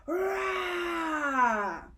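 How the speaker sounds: loud high barking roar; barking rah at the car; dog's bark. Acted. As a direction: bark like a big dog. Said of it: "exclaimed the watchdog".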